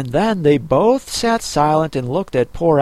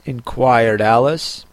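ordinary delivery, nothing marked

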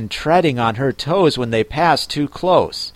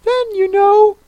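very high-pitched creature voice; Mock Turtle; 3 dB louder than usual. A narrator's reading. Mock Turtle explains the lobster quadrille to Alice, very high voice.

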